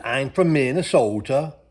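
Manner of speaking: playful Minnesota accent impression. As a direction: imitate a local proudly saying where he's from in a Minnesota accent. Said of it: "the people talk funny here".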